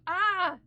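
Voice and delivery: mock dog yelp. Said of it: "when he jumps up and goes".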